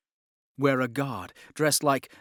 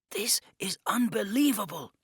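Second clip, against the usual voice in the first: hushed, breathless whisper; an awed whispered aside; murmured whisper; 9 semitones higher than usual. A narrator's reading. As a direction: whisper it in awe. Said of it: "Yusuf murmured".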